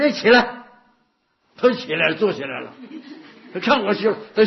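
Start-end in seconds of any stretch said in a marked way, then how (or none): 0.0-0.6 firm loud command. Retold or imitated. Master orders the bedridden nun to get up, loud firm command. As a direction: call it out sharply, higher and louder.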